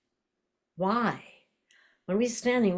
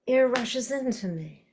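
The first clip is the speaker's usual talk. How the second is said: audible inhale; 7 semitones higher than usual. Shown as a demonstration. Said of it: "like that".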